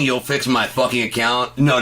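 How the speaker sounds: ordinary talk, nothing marked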